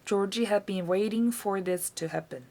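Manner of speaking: ordinary delivery, nothing marked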